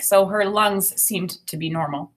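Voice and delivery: ordinary talk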